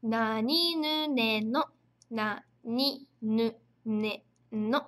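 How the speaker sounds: nani nu ne no read very slowly, each syllable held; teacher reading kana aloud slowly; slow reading drill. Shown as a demonstration. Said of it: "Então, a gente vai ler".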